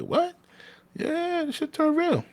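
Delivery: very high, drawn-out mock disbelief; stunned homeboy. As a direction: stretch it out like you can't process it.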